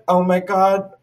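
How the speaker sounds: high-pitched mild panic; a touch of rising alarm; retold panic. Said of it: "I'm, like, panicking".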